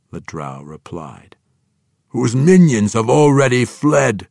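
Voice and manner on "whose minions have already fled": louder, higher growled sneer. A narrator's reading. Orc warlord to drow messenger, growling. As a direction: growl it with contempt. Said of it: "Hartusk said with a growl".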